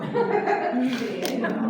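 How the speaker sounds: a big loud rising laugh. Real, not acted. Bursts out laughing.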